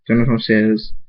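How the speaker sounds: plain reading voice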